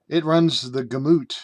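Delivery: "gamut" said as "guh-MOOT"; buddy's mispronunciation. Retold or imitated. Riffing on his buddy's mistakes, gamut mispronounced as guh-MOOT. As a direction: say "gamut" with a long oo, stressed at the end. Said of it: "a P H D in mispronunciation".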